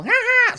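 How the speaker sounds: high, loud witchy cackle; shrill-free high witch laugh burst; witch's cackle demo. Shown as a demonstration. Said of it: "witch's cackle".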